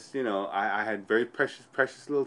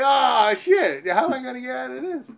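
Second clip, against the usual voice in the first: high, loud, fast alarmed panic; mock panic; 12 semitones higher, 8 dB louder than usual. Patient panicking about escaping the death ship, high and loud. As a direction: blurt out the panic. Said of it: "I'm like".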